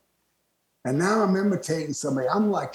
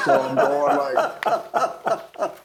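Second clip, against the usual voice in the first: laughing; 3 semitones lower, 3 dB louder, much slower than usual. Real, not acted. Laughing while retelling.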